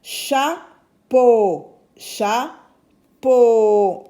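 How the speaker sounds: chapeau in two separated beats, loud long final O; cha, pause, then a held "peau"; syllable by syllable. Shown as a demonstration. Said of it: "j'entends le son o dans chapeau". (chapeau découpé, O final fort et allongé)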